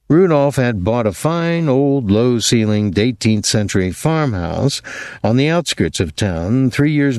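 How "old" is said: ordinary delivery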